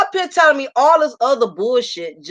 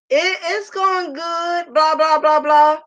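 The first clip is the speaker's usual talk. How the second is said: voicing her friend, high and drawn out; 5 semitones higher, slower than usual. Mimicking her friend on the phone, drawn out and high. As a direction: play the evasive friend, slow and high.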